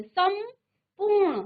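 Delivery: संपूर्ण split slowly, with a held m; teacher sounding out सम-पूर्ण; pronunciation demonstration. Shown as a demonstration. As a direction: hum the m in सम, then पूर्ण. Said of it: "आप उच्चारण में देखिए".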